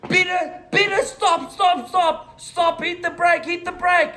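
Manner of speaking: high, panicked mock yell of stop; imitating mom shouting. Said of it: "My mom's shouting".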